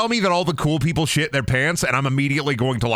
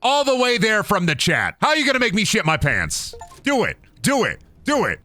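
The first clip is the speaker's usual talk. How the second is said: taunting challenge; 8 semitones higher than usual. Real, not acted. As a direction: goad the viewer on. Taunting chat viewer.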